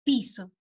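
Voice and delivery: "Piso" with a strong first syllable and a low, falling O; "Piso" stressed as PI-so. Shown as a demonstration. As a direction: lean on the I in "Piso". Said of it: "En la I".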